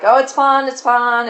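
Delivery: high-pitched, dismissive retold reassurance; breezy reassurance. Rochester to alarmed guests, breezily reassuring. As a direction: imitate someone brushing off a commotion.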